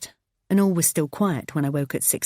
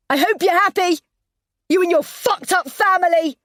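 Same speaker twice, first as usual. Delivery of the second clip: very high, loud furious yell; 14 semitones higher, 7 dB louder than usual. Acted. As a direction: scream it after him. Wife yelling after husband.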